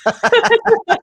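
words through a laugh; chuckling while answering. Real, not acted. Laughs at being stuck.

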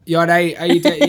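put-on broader Scottish accent. Mimicking self in old videos, broader Scottish accent. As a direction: imitate a thicker Scottish accent, punchy. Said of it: "I watch old videos of myself".

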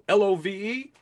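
high, spelled-out love with a whoop; playful cheer. Real, not acted. Host whooping as he spells love to viewers.

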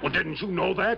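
sudden high, pleading protest; indignant protest. Acted. Miner protesting to the lawman, high and indignant. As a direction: insist he should have known.

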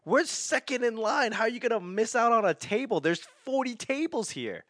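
high-pitched, incredulous questioning; exasperated protest. Retold or imitated. Husband challenging wife over the table, exasperated protest.

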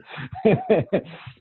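high-pitched chuckle; amused laugh. Real, not acted. Guest laughing about the part of town, high amused laugh. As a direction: laugh at your own story, pitch jumping up. Laughs at his own story.

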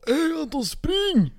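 high, loud mock yell; shouted warning. Friend Adam yelling at the teller to run, shouted. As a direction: call out urgently, imitating a friend.

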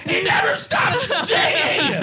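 high, loud laughing yell; laughing shout. Real, not acted. Laughing outburst, then apologizes for yelling.

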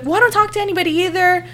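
high, hurried mimicry of the neighbor; mock weird. Imitating the neighbor defending herself, mock weird. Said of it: "she was fucking weird".

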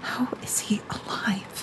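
soft, whispered bewilderment; very quiet, stunned murmur; hushed disbelief. Acted. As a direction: barely voice it, confiding. Siblings whisper about father.

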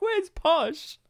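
high, puzzled mock fangirl; girlish dismay, put-on and high; foolish teenage girl. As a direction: play a let-down teenage fan. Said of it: "foolish teenage girls".

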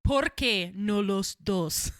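put-on slow, loud Spanish in Scottish accent. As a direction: say "Por qué no los dos" with a Scottish lilt. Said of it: "really hard to say in a Scottish accent".

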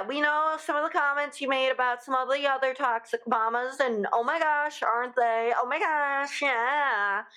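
gossipy excitement. Real, not acted. Gossiping about Lisa's comments.

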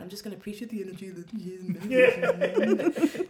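loud, sing-song mocking recital; mock grudging compliance. Agreeing to appreciate the kids, mock grudging compliance. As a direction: parrot the advice with exaggerated reluctance. Grudgingly repeats own advice, audience laughs.

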